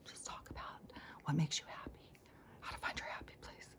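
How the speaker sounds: hushed, unvoiced guru instruction; mock guru whisper. Guru telling followers to go sit in groups, whispered. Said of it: "I talk like this".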